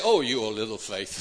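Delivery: low, dropping acted reproach; weary reproach. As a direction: let it sink low, as if just woken. Jesus woken, reproaching disciples.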